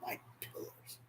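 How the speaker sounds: barely audible whisper; whispered grief. Real, not acted. Grieving man whispers his loss to the room. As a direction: breathe the words out in grief.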